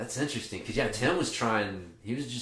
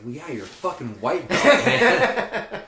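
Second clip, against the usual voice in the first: laughing; 7 semitones higher, 7 dB louder, slower than usual. Retold or imitated. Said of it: "And I'm just like".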